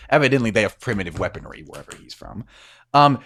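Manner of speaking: ordinary talk, nothing marked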